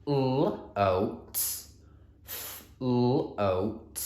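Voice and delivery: "L, oat" said sound by sound. Shown as a demonstration. Teacher doing sound buttons for float, sounding out. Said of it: "let me do my sound buttons".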